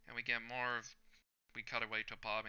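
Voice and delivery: ordinary delivery, nothing marked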